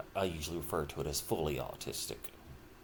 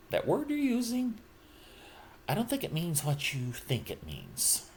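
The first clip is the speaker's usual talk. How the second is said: lightly quoted film line, pitched higher; movie quote; 10 semitones higher than usual. Retold or imitated. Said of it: "as it was said in that movie".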